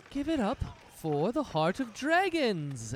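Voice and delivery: high, slow showman's call to the crowd; rally the crowd like a stage host; hyped announcer. Acted. Dancer hyping the crowd after the show, high announcer call.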